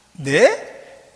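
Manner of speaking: questioning "네?" rising sharply; surprised Mary. Acted. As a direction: ask it back, startled. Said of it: "이 세 마디로 끝납니다". (놀라 되묻는 "네?")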